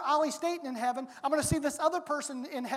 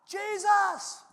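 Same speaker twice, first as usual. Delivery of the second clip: shouting; 6 semitones higher, slower than usual. Acted. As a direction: call out over the crowd. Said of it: "he began to shout".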